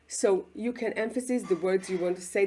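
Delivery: ordinary talk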